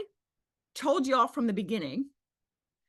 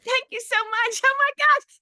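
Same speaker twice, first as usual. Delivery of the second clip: high, gushing mock thanks; mock the gushing over-thanker; gushing caller; 14 semitones higher, 7 dB louder than usual. Coach mocking women who gush on group calls. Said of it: "they said".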